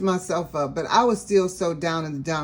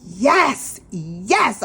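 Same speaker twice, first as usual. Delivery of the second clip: eager yes; 5 semitones higher, 4 dB louder than usual. Retold or imitated. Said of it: "So I was like".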